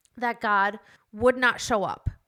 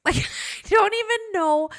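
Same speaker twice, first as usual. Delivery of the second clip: laughing it off, high; a nervous burst of laughter; 10 semitones higher, 3 dB louder than usual. Real, not acted. Laughing at own nerves.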